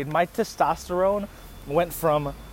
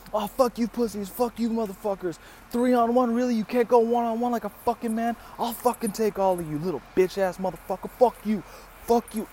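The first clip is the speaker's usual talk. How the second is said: imitated yelling; 6 semitones higher than usual. Girl cursing out the attackers, imitated angry yelling. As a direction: rant in a pitched-up mimic of her. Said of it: "She starts yelling at them".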